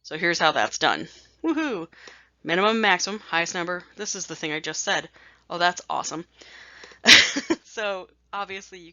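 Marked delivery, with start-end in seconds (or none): 1.4-1.9 high-pitched little woohoo; playful cheer. Real, not acted. Teacher cheering the next step to students, playful cheer. 6.3-7.6 sudden loud breathy laugh; burst of laughter. Real, not acted. Teacher amused by her own slide, loud laugh.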